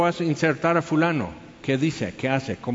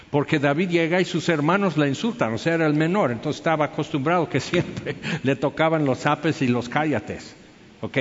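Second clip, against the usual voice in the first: normal talking voice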